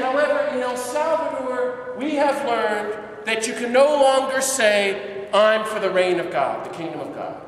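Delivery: loud, high, slowed challenge; fiery pulpit challenge; impassioned preaching. Retold or imitated. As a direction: throw it out like a call to arms. Preacher voices Ellacuría's challenge.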